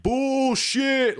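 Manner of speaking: high, loud crowd shout; mock screaming heckle from the crowd; crowd yelling. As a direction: yell it like an outraged crowd. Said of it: "they're yelling and screaming".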